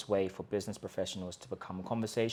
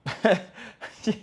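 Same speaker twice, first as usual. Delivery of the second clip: high burst of laughter, real; a sudden high laugh at the joke; 11 semitones higher, 8 dB louder than usual. Real, not acted. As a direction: laugh out loud, amused. Laughs at his own mock.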